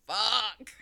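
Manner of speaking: a sudden loud "Fuck."; exasperated loud curse; mock shout. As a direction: blurt it out like a loud groan of despair. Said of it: "You're just like".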